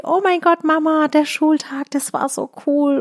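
lightly voiced child, high and slow with delight; a hint of a gushing daughter; excited little girl. Mother imitating Ella raving about her school day, high and slow.

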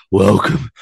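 mock gasping, loud heavy breaths. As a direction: imitate a winded wrestler puffing out a greeting. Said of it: "he's, like, out of breath".

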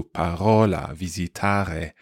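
parola, visitare with a throaty R between vowels, slowly; guttural R. Shown as a demonstration. Teacher showing the northern R in parola, visitare. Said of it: "a sort of guttural R".

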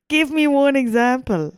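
normal talking voice

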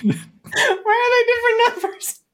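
very high, tearful laughing question; helpless laughter. Real, not acted. Podcaster baffled by the absurd food order, helpless laughter. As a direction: squeak the question out through laughter.

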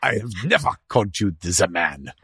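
mock-outraged dog in a thick German accent. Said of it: "a German accent".

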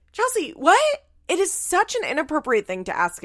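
high, loud, incredulous protest; exasperated outburst. Real, not acted. Scolding mom for treating child like girlfriend, loud high exasperation. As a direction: scold in appalled disbelief. Berates the mother in disbelief.

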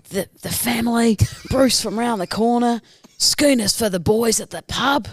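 a gold miner gloating in broad Australian; Australian accent. Acted. A rural Australian miner gloats over his nugget, Australian accent. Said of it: "That was quite a good accent".

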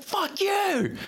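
high shouted curse from the other driver; mock shout back. Other driver yelling back, mock shout. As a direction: yell it back like the angry driver.

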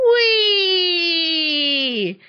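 long high "whee" gliding down; drawn-out storybook squeal of joy; gleeful whee. A narrator's reading. Tortoise sliding through the air, long gleeful whee.

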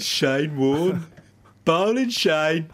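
put-on high, drawn-out Aussie accent; Australian accent. Co-host warming up on request, hammed-up Australian accent. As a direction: warm up with exaggerated Australian vowels. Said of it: "in your best Aussie accent".

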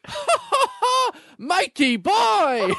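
high slow taunting ha ha; mocking laugh. Housemate mocking the weirdo back, slow high taunting laugh. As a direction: laugh at him, drawn out and high. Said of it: "And he goes".

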